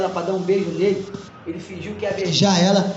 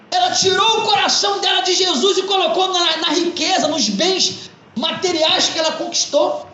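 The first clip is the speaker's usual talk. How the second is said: raised, ringing pulpit voice; fervent preaching; 8 semitones higher than usual. Acted. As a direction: preach it to the congregation with conviction. Pastor preaches to congregation.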